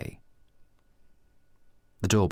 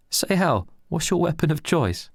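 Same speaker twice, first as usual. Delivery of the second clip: much higher, put-on teasing voice; mocking tease; 11 semitones higher, 3 dB louder than usual. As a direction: needle him playfully. Reese teasing Al.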